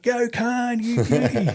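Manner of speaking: high, gleeful mock cheer; yelling out. Bulge egging Khan on despite being told off, high cheering yell. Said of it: "Yelling out and all that".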